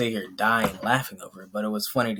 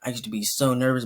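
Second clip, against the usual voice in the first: usual speaking voice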